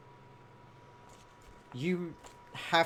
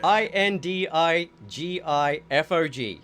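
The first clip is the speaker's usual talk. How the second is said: slow, loud letter-by-letter delivery; spelling out; 5 dB louder than usual. Shown as a demonstration. Host spells the giveaway word for viewers, emphatic. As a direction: call out each letter distinctly.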